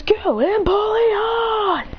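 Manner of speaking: high, slow, drawn-out trainer call; trainer's battle call. Acted. Kukui sending out Empoleon with a high drawn-out call. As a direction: send the Pokemon out with a long high call. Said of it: "He goes".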